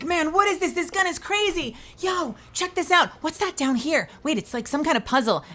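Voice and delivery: high-pitched excited gaming chatter; acted-out excitement mid-game; excited gamer. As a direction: call out each new discovery, high and eager. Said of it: "I'll be like".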